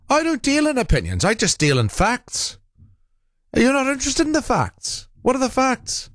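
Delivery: high-pitched impression of a caller's accent; John. Host mimicking a caller's catchphrase about facts, high mock accent. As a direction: pitch it right up in a badly faked Isle of Man accent. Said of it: "I can't do his accent".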